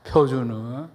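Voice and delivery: usual speaking voice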